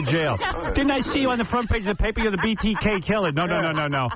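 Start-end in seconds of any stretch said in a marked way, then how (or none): 0.7-3.3 mock accusing stranger. Imitating a stranger accusing him.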